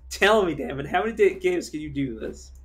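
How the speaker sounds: excited rant. Real, not acted. Ranting about silly game weapons.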